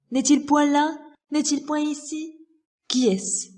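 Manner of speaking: agitated stage miser hunting a thief; frantic miser. Acted. Miser searching for his thief, frantic and outraged. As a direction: fire off frantic questions, bursting into loud, high demands.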